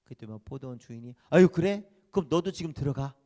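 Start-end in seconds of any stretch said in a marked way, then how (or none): 1.3-3.1 louder, bright invitation; generous owner. Acted. Vineyard owner invites late workers in, louder. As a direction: wave them in generously.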